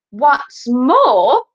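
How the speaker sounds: high, loud, insistent; emphatic child critic; critical schoolboy. A narrator's reading. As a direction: pipe up with another complaint. Ruskin goes on criticising.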